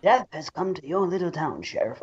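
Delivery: hushed, ominous movie-doctor read, only lightly put on; off the wall Loomis. Host shows off his Loomis to his co-hosts, hushed and ominous. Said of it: "Off the wall Loomis is my specialty".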